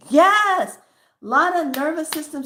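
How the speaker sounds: high, loud burst of agreement; excited yes. Real, not acted. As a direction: belt out a delighted yes. Sudden keen agreement with viewers.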